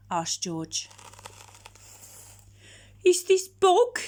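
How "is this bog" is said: howling dog. Acted.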